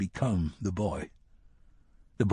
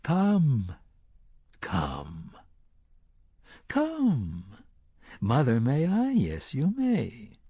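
high, teasing, singsong call; grinning beckoning; 3 semitones higher, much slower than usual. A narrator's reading. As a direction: lure him on like a children's game. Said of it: "grinning and beckoning".

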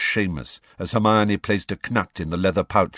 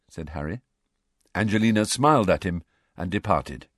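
ordinary delivery

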